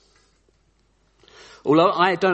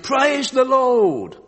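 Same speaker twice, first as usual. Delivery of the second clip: high, very drawn-out shout of praise; 10 semitones higher, 5 dB louder, much slower than usual. Acted. Old lady finding groceries on her porch, shouting. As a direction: holler it joyfully, stretching every word. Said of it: "and shouted".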